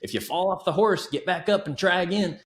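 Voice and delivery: quoting grandpa, pitched well up; a lightly voiced old-timer's folk wisdom; grandpa's saying. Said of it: "as my grandpa used to say".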